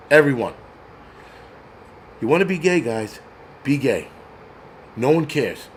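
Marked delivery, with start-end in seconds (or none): none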